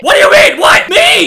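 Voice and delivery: loud, high, flabbergasted questions; incredulous yelping; indignant disbelief. Pretend. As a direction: protest in mock outrage. Protests being told to chase.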